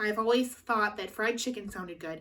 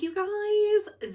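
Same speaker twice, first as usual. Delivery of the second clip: high squeal; 12 semitones higher, 4 dB louder than usual. Real, not acted. Exclaiming to viewers about itchy stretch marks.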